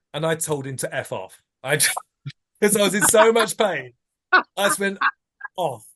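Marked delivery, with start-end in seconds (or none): 1.6-5.9 real laugh breaking into the words, pitched up and louder; laughing through it. Real, not acted. Laughing as he tells hosts he swore at the director. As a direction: laugh it out while telling the painful bit. Laughing at his painful memory.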